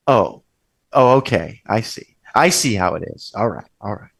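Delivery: low, quiet mock mom resignation; disappointed mother. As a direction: imitate a let-down mom, voice dropped. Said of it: "she won't be like".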